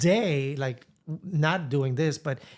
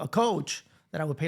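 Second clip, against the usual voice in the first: ordinary delivery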